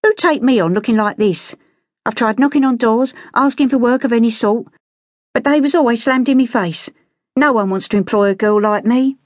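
put-on high-pitched, aggrieved London girl; high Cockney complaint; Cockney street girl, indignant. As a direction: protest bitterly in a high street voice. Street girl protests to gentleman.

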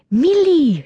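high, loud exasperated shout; loud call, pitch soaring; shouted name. A narrator's reading. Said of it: "she shouted as she shook her".

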